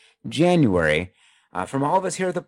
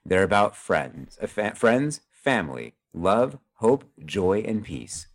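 solemn closing speech; 3 semitones lower than usual. Acted. Host reads a heartfelt holiday message to listeners, lightly announcer style. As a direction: deliver it like a sentimental radio sign-off.

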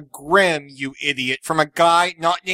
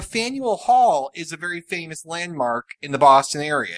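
ordinary delivery

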